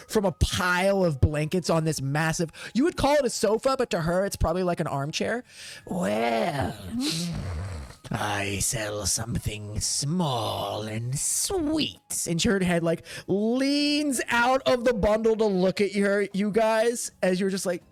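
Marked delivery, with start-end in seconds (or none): 5.9-12.3 very slow, low, drawn-out creature voice; huge dragonborn. Acted. Quintessa sniffs out the small visitor, slow low dragonborn voice. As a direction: savor each word slowly, down low.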